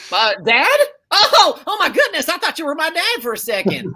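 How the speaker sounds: startled giggle of recognition; surprised laughter. Acted. Texan client laughing, thinking the coach is her dad.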